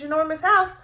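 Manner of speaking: ordinary delivery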